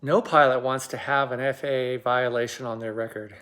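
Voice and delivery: ordinary talk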